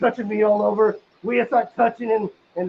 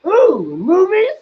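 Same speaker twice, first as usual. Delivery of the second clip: higher, rising ooh of glee; eager delight; 7 semitones higher, 5 dB louder, much slower than usual. Retold or imitated. Answering the girl's movie invite, eager higher ooh. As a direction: perk up like a kid.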